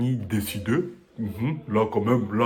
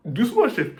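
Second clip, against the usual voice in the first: excited; 12 semitones higher, 8 dB louder than usual. Real, not acted. Joking reply to the ovation.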